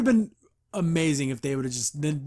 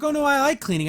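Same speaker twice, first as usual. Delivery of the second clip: chirpy cleaner voice; 10 semitones higher, 5 dB louder than usual. Acted. Voicing the cheerful cleaner.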